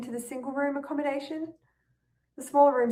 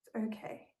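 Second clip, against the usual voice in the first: soft self-soothing; 10 dB quieter than usual. Acted.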